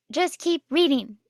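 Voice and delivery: tease, refusing to spoil the ending; mock teasing. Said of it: "And she's like".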